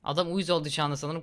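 usual speaking voice

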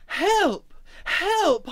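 high, loud cries of alarm; crying out. A narrator's reading. Emma has fallen into the river, crying out. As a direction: cry out as if drowning. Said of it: "Emma cried".

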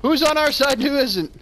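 high, loud, confused protest; flustered outcry. Real, not acted. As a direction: call out in mid-fight confusion. Confused in a sudden fight.

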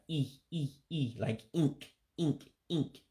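the vowel E said high and sharp, as in ink; E, E, ink, ink with a high clear E; high E sound. Shown as a demonstration. Teacher to learners of Igbo, showing the high E sound. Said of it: "that's more of a high E sound".

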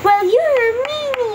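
put-on high-pitched sulky child; childish retort. Child scolding the bully, high indignant retort. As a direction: answer back like a cross kid. Child talks back to villain.

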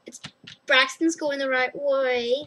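normal talking voice

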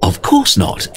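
perplexed. Acted. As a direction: sound baffled by the question. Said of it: "sounding perplexed".

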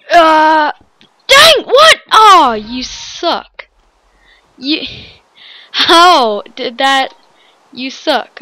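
loud high cry of disbelief, then a drawn-out slow "aw, you suck"; frustrated yell. Real, not acted. Player knocked off the map, loud frustrated yell. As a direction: yell it, then drag out the complaint.

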